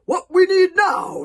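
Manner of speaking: loud, high, overconfident chief; forced authority. Acted. Stoic addresses the scared Vikings, loud forced authority. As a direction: take charge loudly, as if in control. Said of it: "trying to sound as if he was on top of the situation".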